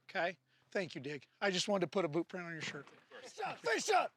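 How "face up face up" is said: barked drill command. Real, not acted. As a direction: call the class to attention. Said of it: "drill commands to class".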